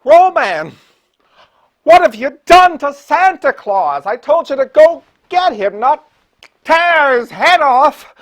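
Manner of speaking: loud, high-pitched horrified scolding; scolding outrage. Acted. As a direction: tell off a bungling underling at full volume. Host scolds Roman for hurting Santa.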